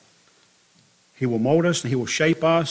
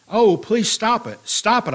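crying out, pleading; 7 semitones higher, 5 dB louder than usual. A narrator's reading. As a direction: beg for it to stop, falling away at the end. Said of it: "I cried".

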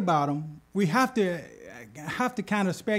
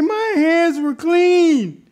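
high, loud, slow desperate claim; desperate plea; 10 semitones higher, 6 dB louder, much slower than usual. Acted. Frightened king pleads to God, high, loud, slow. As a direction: beg to be believed. Terrified Abimelech repeats plea.

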